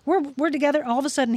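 ordinary talk

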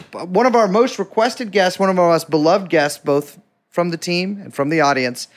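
normal talking voice

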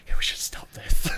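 retold realisation in a breathy half-whisper; hushed admission. Retold shared realisation after drinking spree.

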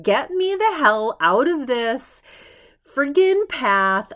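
high, loud, slow exasperated cry; fed-up outburst. Retold or imitated. To friends on the lost desert trail, a loud slow fed-up cry. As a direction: drag it out, desperate to be done. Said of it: "I was like".

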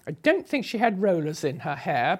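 ordinary talk

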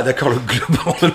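ordinary delivery

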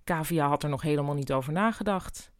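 plain reading voice

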